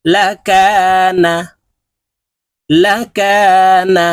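patient tutor reciting a reading drill; slow spelling drill. Shown as a demonstration. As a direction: sound out and blend each word slowly for learners. Teacher continues spelling drill.

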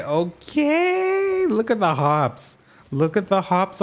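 excited squeal. Real, not acted. Squeals at new location.

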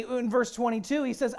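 normal talking voice

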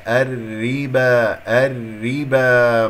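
الربا slow, with a thin ر and long final "aa"; light ra. Shown as a demonstration. Teacher modelling the right sound, light ra. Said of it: "مكسورة تكون مرققة".

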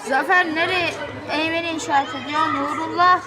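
ordinary talk, nothing marked

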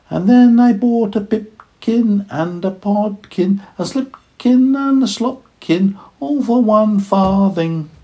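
high, slow squeaky mouse voice; high little mouse voice. A narrator's reading. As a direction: tease it like tiny mice. Mice singing a rhyme.

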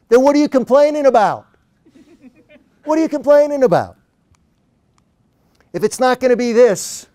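high, loud, put-on Australian exclamation; cheery Australian accent. Coach challenges his worried client, cheery Australian accent. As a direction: imitate an exasperatingly upbeat Australian, pitched high. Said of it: "in his annoyingly cheery Australian accent".

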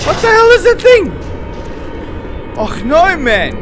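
panicked high shouting; terrified yelling, out of energy; being chased. Real, not acted. Still fleeing monster.

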